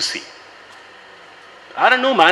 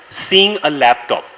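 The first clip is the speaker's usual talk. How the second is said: ordinary talk, nothing marked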